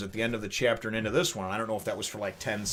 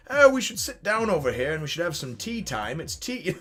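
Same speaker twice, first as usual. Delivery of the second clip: much higher, genteel mock-dainty voice; prim tea-party voice; 6 semitones higher, 3 dB louder than usual. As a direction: play a dainty host inviting to tea. [name] acts prim at tea.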